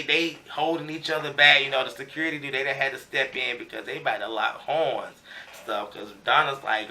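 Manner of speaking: normal talking voice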